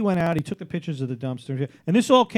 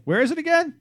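much higher-pitched imitation of a confused colleague; mock clueless question; 12 semitones higher than usual. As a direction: raise the voice high, mocking his confusion. Imitating confused colleague coming back.

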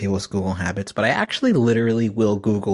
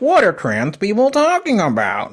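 higher, louder puzzled question; clueless asker voice; 9 semitones higher, 5 dB louder than usual. Retold or imitated. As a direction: play the baffled outsider asking.

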